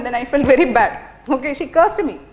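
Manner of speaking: usual speaking voice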